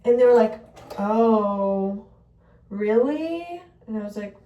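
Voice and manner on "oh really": loud drawn-out oh, then rising question; mock taken-aback, lifting at the end; doubtful surprise. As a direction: imitate a skeptical reaction. Retells shelter staff's doubtful reaction.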